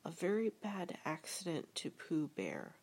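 ordinary delivery

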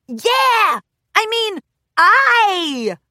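high, loud eager cheer, slow on aye; excited shout. Acted. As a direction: join in like an eager pirate. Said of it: "Rainbow Dash echoed".

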